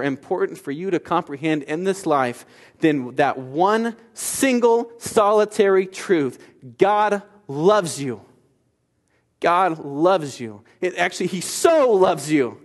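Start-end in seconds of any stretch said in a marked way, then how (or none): 2.8-12.6 slow, higher, weighty "God loves you"; slow emphatic declaration. Acted. Preacher assuring congregation, slow and emphatic. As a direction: lean on "loves", slowly.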